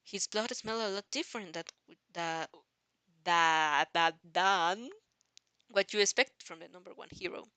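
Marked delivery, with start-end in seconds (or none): none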